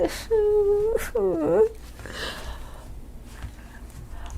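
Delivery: a sudden high-pitched squealing laugh; high squeal of laughter. Real, not acted. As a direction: let a bright squeal of laughter burst out. Streamer laughs at chat comment.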